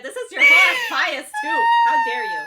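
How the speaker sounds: very high, loud indignant shriek; mock outrage. Friend scolding a co-host for laughing, mock outraged shriek. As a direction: squeal it in fake offense.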